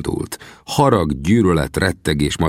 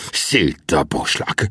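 very slow, drawn-out hiss; hissed threat; much slower than usual. A narrator's reading. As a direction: hiss it with hatred. (gyűlölködve, lassan sziszegve)

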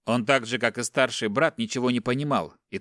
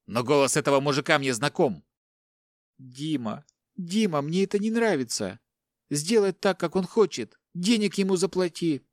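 higher, anxious begging; panicking wife; 3 semitones higher than usual. Acted. Wife begging husband to pay, higher and anxious. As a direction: plead urgently, voice up.

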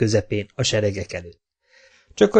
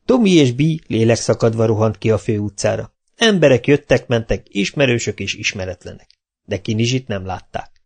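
ordinary delivery, nothing marked

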